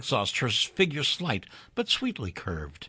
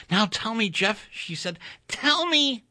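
higher, pleading woman's voice; eager young woman; 9 semitones higher than usual. A narrator's reading. Doris begs Jeff for words of love, higher and eager. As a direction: coax him to say it.

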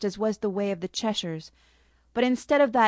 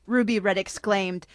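usual speaking voice

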